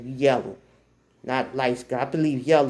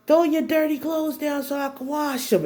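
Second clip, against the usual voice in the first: high-pitched imitated holler; mom yelling; 13 semitones higher than usual. Mom orders laundry thrown down within earshot of crush, yelling. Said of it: "She literally yelled".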